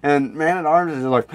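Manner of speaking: normal talking voice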